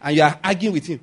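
ordinary delivery

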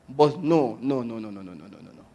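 repeated no's sinking low and very soft; dropping in pitch and volume as it repeats; fading dismissal. Real, not acted. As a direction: let the refusal die away to almost nothing. Dismissing another man's testimony, trailing away.

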